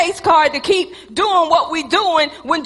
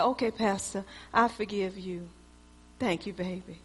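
much softer and lower, gently; soft forgiving; 10 semitones lower, 16 dB quieter than usual. Retold or imitated. Wife Teresa quietly forgiving her pastor husband, soft and gentle. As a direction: let him off the hook softly.

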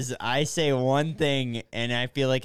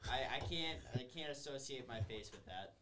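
distant, off-mic voice; speaking from across the room; far from mic; 3 semitones higher, 16 dB quieter than usual. Real, not acted. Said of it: "sound far away, it's 'cause I am".

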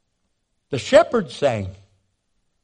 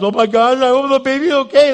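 higher, louder anxious cry; panicked mother; 7 semitones higher, 8 dB louder than usual. Retold or imitated. Expecting mother panicking over her unmoving baby. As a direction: let the worry burst out loud.

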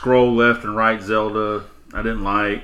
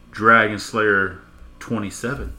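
slow reveal; slower than usual. Retold or imitated. Boyfriend reveals gamer tag.

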